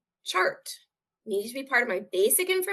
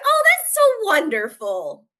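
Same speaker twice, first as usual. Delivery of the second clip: 1 gushing nurse; 10 semitones higher, 9 dB louder, slower than usual. Retold or imitated. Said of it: "And she went".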